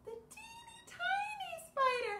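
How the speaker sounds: tiny squeak; teeny tiny voice. Shown as a demonstration. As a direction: shrink the voice to a tiny spider. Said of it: "what about".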